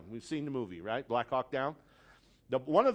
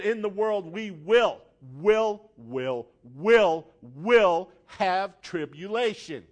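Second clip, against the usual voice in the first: will repeated, louder and building before slow will have tribulation; stuttered emphasis; 7 dB louder, slower than usual. Retold or imitated. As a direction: hammer the word will again and again. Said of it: "he said".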